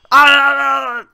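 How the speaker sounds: sharp scream of fright; short terrified yelp; mother shrieks; frightened cry. Acted. Mother cries out.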